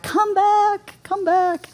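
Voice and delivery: high mock call to come back; high-pitched mock beckoning. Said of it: "They're like".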